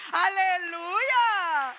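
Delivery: loud, drawn-out hallelujah cry; joyful shout. Acted. Pastor acts a member calling with good news, shouting for joy.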